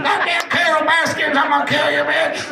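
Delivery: high, hammed-up angry rant; Joe Exotic. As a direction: shout it as a raging cowboy impression. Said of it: "That's how he talked".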